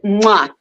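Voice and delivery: smacking kiss sound; blown kiss. Real, not acted. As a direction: blow the host a kiss. Caller blowing a kiss to host.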